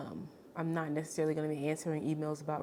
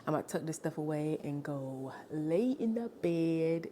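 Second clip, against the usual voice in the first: ordinary delivery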